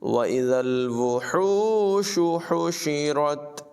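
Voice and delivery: slow chanted tajweed recitation; Quran recitation. Shown as a demonstration. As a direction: recite it melodically, drawing out the vowels. (धीमी, सुरीली क़ुरान तिलावत)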